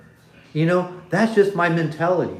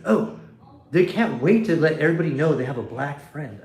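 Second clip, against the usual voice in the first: usual speaking voice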